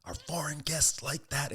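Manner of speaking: a hint of a stern mob boss. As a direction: scold them slowly and gravely. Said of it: "He's like".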